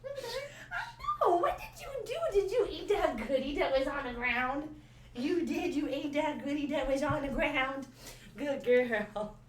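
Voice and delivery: very high, squeaky praise for a dog. Real, not acted. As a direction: coo at the dog like a puppy. Baby talk to the dog.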